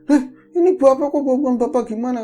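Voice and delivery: high, loud acted disbelief; baffled protest. Father answering the child who disowns him, baffled protest.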